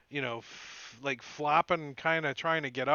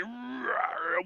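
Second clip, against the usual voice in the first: mock roar; 9 semitones higher than usual. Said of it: "He's like".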